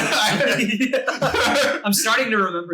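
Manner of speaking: laughing through the words; giggling while talking. Real, not acted. Laughing while recalling story.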